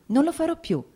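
mother repeats her promise in tears; rising tearful promise. A narrator's reading. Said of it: "inghiottendo le lacrime".

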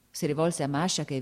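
ordinary delivery, nothing marked

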